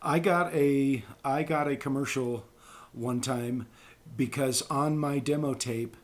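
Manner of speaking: ordinary talk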